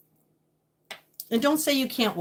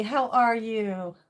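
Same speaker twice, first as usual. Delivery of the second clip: ordinary talk, nothing marked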